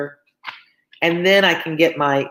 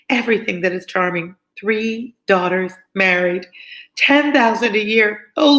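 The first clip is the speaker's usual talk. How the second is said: gushing Mrs Bennet; 4 semitones higher than usual. Acted. Mrs Bennet gushing to Lizzie over her engagement. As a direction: swoon over her daughter's riches. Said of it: "such an effusion".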